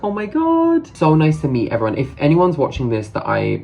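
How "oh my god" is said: high-pitched delighted reaction; retold excited squeal. Vlogger retelling being recognised by other fans, imitated excitement. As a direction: act out an excited squeal at normal volume.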